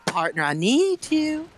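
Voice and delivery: slow Southern drawl, leaning on need; country accent. Retold or imitated. Retelling the far-off teammate calling for help, country accent. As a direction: plead in a drawn-out country twang. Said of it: "She'll be like".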